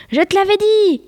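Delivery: high, triumphant I-told-you-so; victorious cry. A narrator's reading. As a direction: crow it high like a boy who has won. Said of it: "clama le garçon victorieux".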